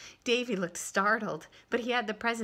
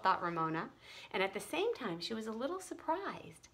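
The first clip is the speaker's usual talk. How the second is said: plain reading voice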